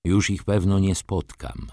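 ordinary delivery, nothing marked

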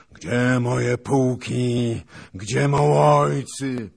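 slow, loud hoarse wail; raging hoarse cry of a beaten commander; hoarse roar. A narrator's reading. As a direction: rasp it out high and drawn-out. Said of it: "powtarzał chrapliwym głosem".

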